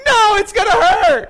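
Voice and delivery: put-on high, loud child's wail; scared daughter. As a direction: cry out like a frightened little girl. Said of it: "she's like".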